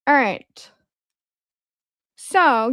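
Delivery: normal talking voice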